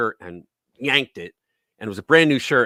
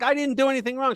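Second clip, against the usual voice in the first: put-on much higher, kid's protesting whine; whiny kid protest; 13 semitones higher, faster than usual. Boy protesting to his mother while being slapped, high whine. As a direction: pitch it up like a wronged kid pleading.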